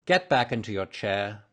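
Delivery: firm, stern command; stern detective cutting off the pleading. A narrator's reading. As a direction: order it sternly, with controlled force. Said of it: "said Holmes sternly".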